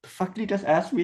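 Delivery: high-pitched bewildered mimicry; acted-out puzzled disbelief; confused. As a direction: imitate someone baffled by what they just heard. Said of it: "he's, like, confused".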